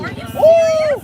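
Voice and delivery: loud, high disbelief; surprised shout. Real, not acted. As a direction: shout it in amazement. Surprised outcry.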